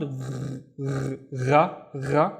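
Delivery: gargle the "gh" at the back of the throat; gargling gh. Shown as a demonstration. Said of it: "the gargling sound".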